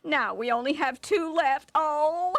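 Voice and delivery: high reproachful wife's voice; scolding wife. Acted. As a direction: nag him over the silly sausage. Wife scolds over sausage.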